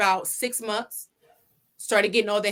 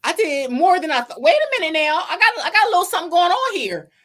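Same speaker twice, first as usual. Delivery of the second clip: excited realization; 8 semitones higher, 6 dB louder than usual. Retold or imitated. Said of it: "I was like".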